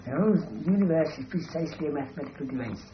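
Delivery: quick, prim lecturing voice; lightly voiced pedantic philosopher; mock Bertrand Russell. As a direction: rattle it off like a dry logician. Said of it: "And then they talk like that".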